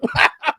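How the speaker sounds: gloating laugh in character; mock laugh. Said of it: "Trump admits".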